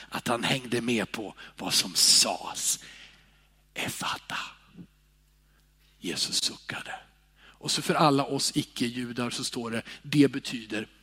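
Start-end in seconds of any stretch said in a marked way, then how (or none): 3.7-4.4 whispered word. Acted. Preacher voicing Jesus to deaf man, whispered. 6.0-9.8 whispered, softer; hushed whisper. Real, not acted. Preacher telling the healing, whispered.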